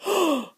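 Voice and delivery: sharp dramatic gasp; quick startled intake. Acted. Gasp between lines.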